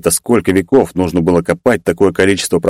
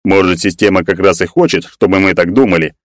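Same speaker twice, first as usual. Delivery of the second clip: ordinary delivery, nothing marked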